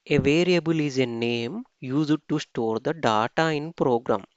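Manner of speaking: reading rule text aloud very slowly, word by word; slow dictation read. Acted. Teacher reading the slide rules slowly to students. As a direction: spell it out slowly for the class. Reads the definition.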